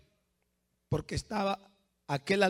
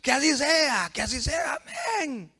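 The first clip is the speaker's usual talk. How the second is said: lightly acted crowd chant, raised pitch; a hint of a cheering people; crowd's response; 7 semitones higher than usual. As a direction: answer like an assenting crowd. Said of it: "todo el pueblo dice".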